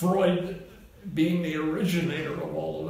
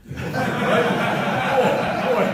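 louder, higher through a laugh; cracking up at the joke; 7 semitones higher, 8 dB louder, much slower than usual. Real, not acted. Laughing at his own joke.

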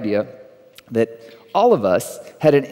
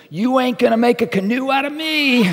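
higher, laughing through the defiant line; laughing punchline; 10 semitones higher than usual. Acted. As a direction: crack up while delivering the punchline.

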